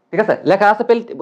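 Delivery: ordinary talk, nothing marked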